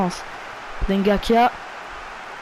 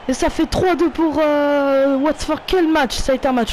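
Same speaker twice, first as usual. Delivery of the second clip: goal celebration; 6 semitones higher than usual. Real, not acted. Gamer celebrating the late winning goal, ecstatic shouting. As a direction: erupt with joy at the winner.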